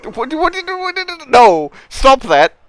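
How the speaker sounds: alarmed protest. Acted. Player acting a panicked figure, high and loud. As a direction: yelp it out in shocked protest. In-character protest during game.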